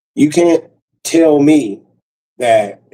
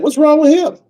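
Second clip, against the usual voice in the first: high-pitched mock questioning; imitated ex; 14 semitones higher, 3 dB louder than usual. Ex asking about the dog, high mimicking voice. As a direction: put on a much higher voice for the ex.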